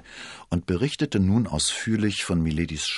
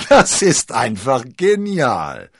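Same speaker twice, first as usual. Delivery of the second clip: high, loud, drawn-out cry of delight; laughing exclamation; 7 semitones higher, 10 dB louder, slower than usual. A narrator's reading. Cardinal admires D'Artagnan's trick, exclaiming in laughter. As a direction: call it out through the laughter. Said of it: "rief er".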